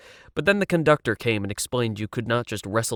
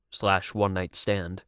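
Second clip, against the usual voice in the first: ordinary delivery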